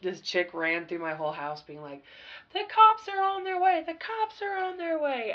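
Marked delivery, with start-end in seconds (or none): 2.5-3.8 higher, alarmed mock shout; mock panicked screaming. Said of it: "screaming that". 3.8-5.3 higher, fading alarmed repeat; mock panicked screaming. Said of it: "screaming that".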